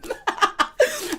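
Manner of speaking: high-pitched burst of laughter; real laugh. Real, not acted. Laughing at own chicken-seasoning joke, high-pitched cackle. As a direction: crack up at your own joke.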